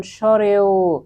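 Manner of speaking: স্বরেও slowly, last vowel held long; স্বরেও modelled for pupils to repeat; pronunciation demo. Shown as a demonstration. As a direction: stretch the final sound of স্বরেও. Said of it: "তোমরা একবার উচ্চারণ করে দেখো".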